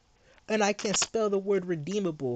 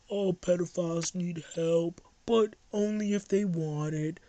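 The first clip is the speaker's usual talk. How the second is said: slow, dopey mock voice of a rival; mocking voice of the other guy; 2 semitones higher, slower than usual. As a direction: parody him as a dimwit, slowly. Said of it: "I'm voicing you. This is how you sound to me".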